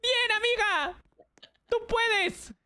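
very high-pitched cheer; excited cheering. Real, not acted. Cheering her friend through the level, high-pitched excitement. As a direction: cheer her on at the top of your range.

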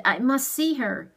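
firm, pressing demand. A narrator's reading. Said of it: "he demanded".

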